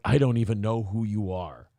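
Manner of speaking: low, dismissive mock villain line; lightly voiced unimpressed brush-off; Thanos. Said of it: "giving him the Thanos treatment".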